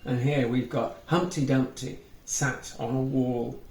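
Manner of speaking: ordinary delivery, nothing marked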